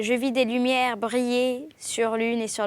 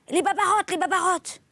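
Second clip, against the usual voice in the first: high, panicked shriek; cry of distress; 7 semitones higher, 3 dB louder than usual. A narrator's reading. Old servant discovering vermin in the kitchen, distressed high cry. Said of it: "poussa un cri de détresse".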